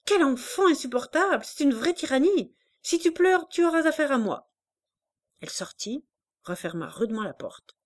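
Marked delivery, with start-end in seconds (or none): 0.0-4.5 higher, louder exasperated scolding; furious mother shouting; shouting angrily. A narrator's reading. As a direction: snap at the child to stop her. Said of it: "en criant".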